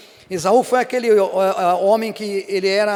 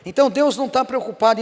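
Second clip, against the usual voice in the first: normal talking voice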